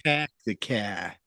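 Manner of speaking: dropped r, "pack the car" as "pahk the cah"; clipped Boston "cah" with no r; Boston accent. Pretend. Co-host corrects the Boston accent, saying "pack the car". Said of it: "horrible Boston accent".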